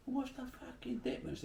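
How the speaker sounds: squeaky-high imitated retort; mock indignant challenge. Acts out their challenge to him.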